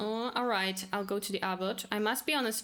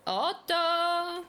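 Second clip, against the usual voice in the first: calling out; 7 semitones higher, 3 dB louder than usual. Acted. Calling out to Otto.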